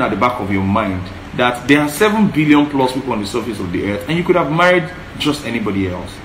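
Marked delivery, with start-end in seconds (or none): none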